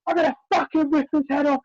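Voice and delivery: retold rage, high and shouted; furious yelling. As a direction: yell it like an enraged mum backstage. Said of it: "all I could hear from the back is".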